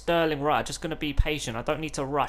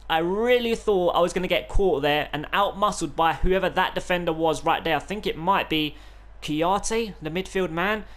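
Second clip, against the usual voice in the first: ordinary delivery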